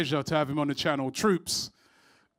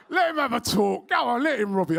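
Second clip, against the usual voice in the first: laughing retelling; 7 semitones higher than usual. Retold or imitated. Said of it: "Tal goes".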